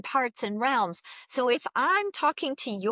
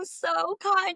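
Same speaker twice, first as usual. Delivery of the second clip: normal talking voice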